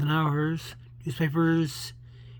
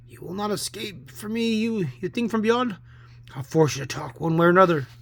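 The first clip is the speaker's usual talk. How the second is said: forceful, rising to a louder finish; threatening command; 5 semitones higher, 4 dB louder than usual. Acted. Man forcing a ghost to talk, commanding. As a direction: confront the specter with bold menace.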